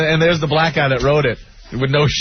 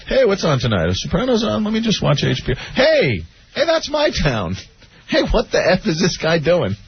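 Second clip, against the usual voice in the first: mock cop voice; 6 semitones higher than usual. Cop watching himself on TV.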